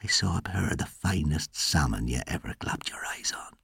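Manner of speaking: soft, breath-only storyteller's whisper. A narrator's reading. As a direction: drop to a whisper as if sharing a secret. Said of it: "lowered his voice to a whisper".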